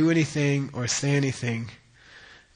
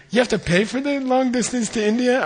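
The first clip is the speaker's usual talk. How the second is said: high, astonished question, a bit louder; surprised teacher; 10 semitones higher, 3 dB louder, faster than usual. Retold or imitated. Teacher learns the India calls cost money, asks in high surprise. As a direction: ask it in amazed disbelief. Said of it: "he's like".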